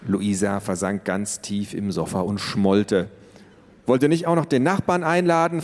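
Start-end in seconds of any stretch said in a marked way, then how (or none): 3.9-5.6 mock the parents sulkily; sarcastic. Sulking teen mocking her parents, sarcastic. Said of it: "fragte sie".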